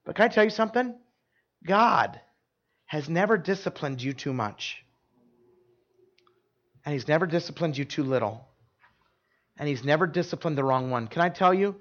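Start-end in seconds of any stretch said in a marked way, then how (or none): 0.1-8.5 quiet, earnest reassurance with long pauses; soft sincere. Real, not acted. Pastor assuring listeners of God's care, softly. Turn from joke to truth. 9.6-11.1 quiet, earnest reassurance; sincere and soft. Real, not acted. Pastor reassuring the congregation about God, soft and sincere. Turn from joke to truth.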